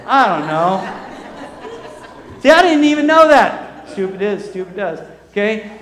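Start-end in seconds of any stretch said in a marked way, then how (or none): 0.1-2.4 very slow words laughed through; sheepish laugh. Retold or imitated. Sheepish answer to wife. 2.4-3.9 much higher, louder burst; excited exclamation. Real, not acted. Punchline to the congregation.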